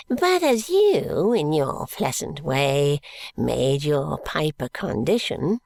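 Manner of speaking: put-on a slow quavering old miser; old man voice. A narrator's reading. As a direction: croak it like a crafty old miser.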